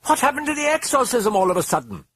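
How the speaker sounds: very high, loud hysterical shriek; frantic mother shrieking. Acted. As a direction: shriek it at him. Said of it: "Chris shrieked at him".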